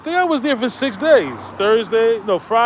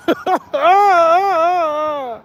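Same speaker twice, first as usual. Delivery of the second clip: high laugh; 8 semitones higher than usual. Real, not acted. Laughing at his own jab.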